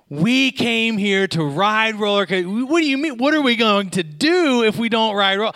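high, loud, exasperated outburst, cut off mid-word; hammed-up indignant girlfriend; furious. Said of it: "she is furious".

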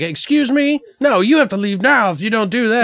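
high-pitched affronted refusal; hammed-up indignation; mock indignant manager. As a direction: act scandalized, voice jumping up. Acted manager rejects guest's bribe.

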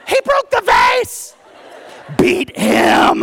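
high, strained full-out yell; outraged shout. Acted. Older brother protesting little brother's light punishment, outraged shout. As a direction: scream it in disbelief, demanding justice. Outraged sibling demands a whipping.

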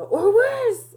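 impassioned outburst, high and loud; worked-up uncle raising his voice. Retold or imitated. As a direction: punch it out high and indignant, short of a yell. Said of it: "he's raising his voice".

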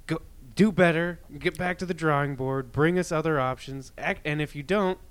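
loud, high demanding voice; angry demand. Pretend. Voicing demands to politicians.

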